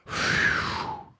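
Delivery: breathy whew of relief; relieved whew; whispered relief sigh. Acted. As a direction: blow out relief. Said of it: "said Mister Fox".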